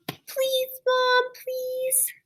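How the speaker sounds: put-on high, soft pleading child's whine; whining child. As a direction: beg like a kid nagging mom. Narrator imitates child begging mom.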